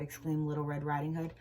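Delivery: usual speaking voice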